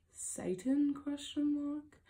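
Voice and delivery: mock hesitant question. Driver joking about the glowing red sign, mock hesitant question. Jokes guessing the sign's meaning.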